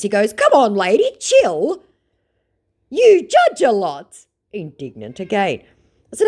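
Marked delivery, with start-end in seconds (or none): 0.3-4.3 high put-on voice, slowing at the end; sulky scammer parody; mock scammer voice. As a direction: caricature him telling her to relax. Voicing scammer.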